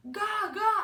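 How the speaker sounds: put-on high, loud baby wail; loud, high-pitched babble; crying baby babble. A narrator's reading. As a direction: cry out like an infant wanting something. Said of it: "started to cry".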